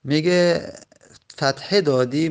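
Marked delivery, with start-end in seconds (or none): none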